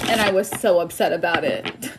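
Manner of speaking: usual speaking voice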